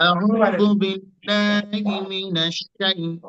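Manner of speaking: slow chant stopping and repeating shy-; Arabic recitation, very slow; recitation with stops. Shown as a demonstration. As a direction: break the recitation to show শাইতান. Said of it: "যেমন ধরেন".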